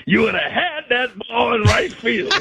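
high, shouted tease; mock shout. Teasing a buddy about a catch, mock shout. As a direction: call it out high like a heckle.

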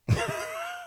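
a high, breathy squeal of a laugh; tiny high-pitched cackle; high squeaky laugh. Real, not acted. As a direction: burst into a squeaky giggle. Laughing at own joke.

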